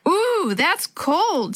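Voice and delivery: high, drawn-out yelp at the cold; mild yell. A narrator's reading. Boy splashed by cold river water, mild yell. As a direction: yell it high, without real volume. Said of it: "Sammy yelled".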